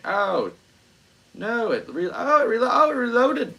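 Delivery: high, loud cry of dismay; surprised. Real, not acted. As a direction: react in alarm as the page reloads. Page reloads unexpectedly.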